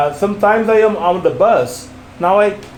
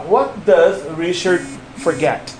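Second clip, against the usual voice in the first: slow and word by word, with space after "does" and "Richard"; slow, careful model. Shown as a demonstration. Teacher showing a learner not to rush, slow and careful. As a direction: take your time over each word, pronounced precisely. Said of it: "It doesn't matter if you say".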